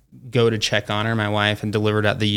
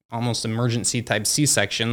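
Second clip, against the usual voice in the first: normal talking voice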